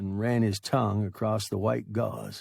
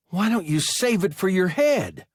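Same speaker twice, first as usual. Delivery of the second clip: put-on a hurt boy's high, whine, louder than the narration; high whining boy; 8 semitones higher, 7 dB louder than usual. Injured boy sneers at the pilot, high and whining. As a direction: whine it up high and sharp at him. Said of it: "that high, whining voice".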